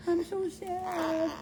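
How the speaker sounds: put-on high, slow whimpering baby voice; mock sad. Mom voicing sad baby before shots, high whimper.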